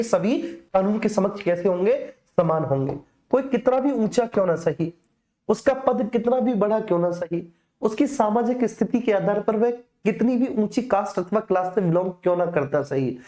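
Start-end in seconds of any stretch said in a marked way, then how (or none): none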